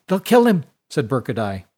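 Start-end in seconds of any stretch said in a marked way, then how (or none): 0.1-0.6 high, loud panicked cry; frantic warning. Acted. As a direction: blurt it out in panic.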